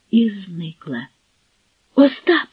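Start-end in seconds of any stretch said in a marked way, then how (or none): 1.9-2.5 high, startled outcry; cry out. A narrator's reading. Girl spotting the eavesdropper, startled outcry. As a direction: shout the name in alarm. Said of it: "скрикнула Зуся".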